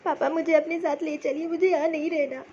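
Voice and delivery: low, subdued, mildly tearful pleading; tearful plea. Acted. Sick daughter begging father to take her along, tearful.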